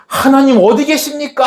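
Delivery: very high, exasperated cry; despairing temper. Retold or imitated. Elisha striking the water, acted despairing outburst. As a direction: act out a frustrated outburst at God. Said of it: "승질 부리면서".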